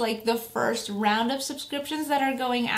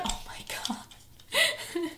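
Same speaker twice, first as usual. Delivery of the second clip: awed whisper; 8 semitones higher, 7 dB quieter, much slower than usual. Real, not acted. Sees Ariel button.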